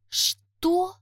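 high, sharp shocked gasp of a question; stunned. Acted. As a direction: blurt it out in disbelief. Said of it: "ошарашенно".

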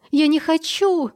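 high, slow cry, voice cracking; voice breaking. A narrator's reading. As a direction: scream until the voice breaks. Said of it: "срывая голос". (срывающийся голос)

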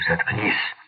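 mild bark of annoyance; understated, curt order to shut up; a touch of a bark. A narrator's reading. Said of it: "рявкнул Хоган".

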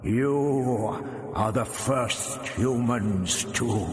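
very slow, echoing alien voice; booming hollow voice. A narrator's reading. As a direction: draw out each word like a grating, all-powerful creature. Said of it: "It boomed almost as hollowly".

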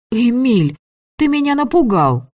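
high, loud startled cry; startled exclamation. Acted. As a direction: jump and cry out in fright. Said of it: "воскликнула я".

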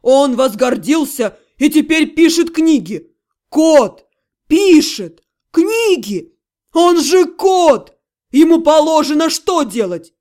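loud indignant demon tirade; Ёшка. A narrator's reading. Demon outraged that his cat writes books, barking. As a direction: rant loudly, stretching the key words. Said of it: "рявкнул Ёшка".

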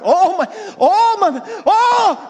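hammed-up, very high, loud wailing; acted panicked yelling, stretched out; screaming in panic. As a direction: scream like terrified men in a storm. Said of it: "they're yelling and they're screaming and they're crying".

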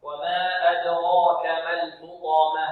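slow recitation, "الحطمة" ending in an audible h; clear final ha. Shown as a demonstration. Teacher showing the clear ha at the stop, measured recitation. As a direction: recite "وما أدراك ما الحطمة" slowly, sounding the last ha. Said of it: "لابد من إيضاح ماذا".

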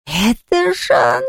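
slow, high whiny ghost; whining. Acted. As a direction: whine it out sulkily. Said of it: "захныкало привидение".

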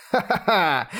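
high cackling laugh; a smug bird's ha-ha-ha; gleeful parrot cackle. Acted. Parrot Lord Fifth laughs.

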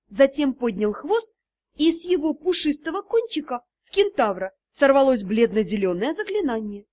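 usual speaking voice

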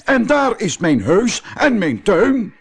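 put-on high, thrilled old professor voice; excited professor. Professor calling out from the air, high and thrilled. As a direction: crow with delight at flying over the village. Professor delighted while flying.

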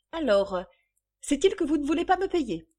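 high, loud, fast challenge; indignant creditor. Acted. Creditor demanding payment, indignant. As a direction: fire the question at her angrily. Creditor quarrels over unpaid bill.

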